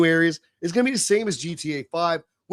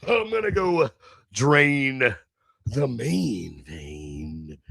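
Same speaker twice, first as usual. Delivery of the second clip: slow, much lower quoted punchline; quoting Roadhouse; 6 semitones lower, much slower than usual. Retold or imitated. Quoting Roadhouse before a bathroom break, slow and low. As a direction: drop into a lazy movie-quote delivery. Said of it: "as the quote from Roadhouse goes".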